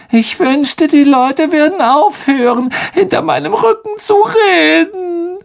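high, choked and sobbing; tearful. Acted. Myrte to the three, high and choked with tears. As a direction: near tears, wounded. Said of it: "mit tränenerstickter Stimme".

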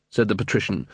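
plain reading voice

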